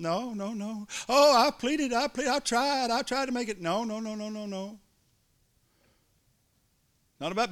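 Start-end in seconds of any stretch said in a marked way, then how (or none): 1.1-3.6 high, fading self-pitying whine; hammed-up plaintive whimper, higher pitched; whiny pleading. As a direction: overplay the helpless complainer. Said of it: "mock complainer voice".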